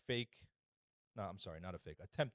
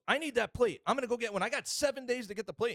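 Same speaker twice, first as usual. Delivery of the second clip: high, loud imitation of a frantic driver; hammed-up high-pitched outrage; mock insane demanding; 11 semitones higher, 4 dB louder than usual. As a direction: insist wildly, voice pitched way up. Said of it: "The lady goes insane".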